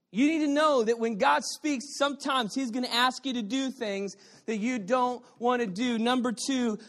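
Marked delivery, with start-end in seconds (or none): none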